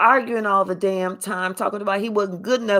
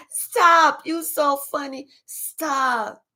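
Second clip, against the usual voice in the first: giggly flirting; 6 semitones higher, slower than usual. Retold or imitated. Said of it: "we all go".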